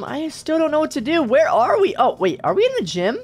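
ordinary talk

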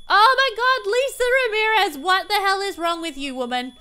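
loud, high exasperated yelling at the screen; exasperated shout. Real, not acted. Gamer yelling at her sim who set the kitchen on fire, exasperated. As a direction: yell at her in disbelief.

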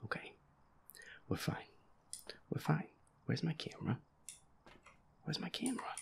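normal talking voice

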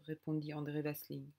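ordinary delivery, nothing marked

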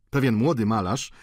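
ordinary delivery, nothing marked

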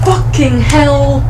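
slow, drawn-out shocked cry of fright; real fright. Real, not acted. Scared by her partner sneaking up, a slow frightened cry.

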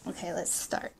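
soft whisper to herself; whispered start. Real, not acted. Vlogger about to begin the French lesson, whispering. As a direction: breathe it out quietly before diving in.

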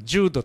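ジュード with a long "joo" and short "do"; ジュード, "JOO-do", as a foreign word; European-style pronunciation. Shown as a demonstration. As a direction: say ジュード as an approximate European form. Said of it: "とかっていう言い方を".

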